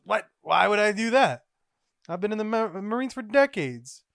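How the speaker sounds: high, loud protest; indignant. Acted. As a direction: protest loudly as if insulted. Garp acted protesting to subordinate.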